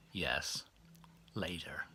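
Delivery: low, near-whispered reply; soft, hushed and sheepish; embarrassed whisper. Retold or imitated. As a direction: mumble it, cornered.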